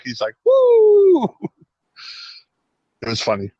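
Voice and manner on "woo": high, loud wrestling "Woo!"; Ric Flair woo. Retold or imitated. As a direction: let out a big wrestler whoop. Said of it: "like Ric Flair fucking going on a fucking woo attack".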